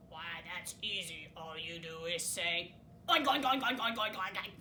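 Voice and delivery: a lazy pig explaining; low pig voice. Acted. Mister Pig's low voice.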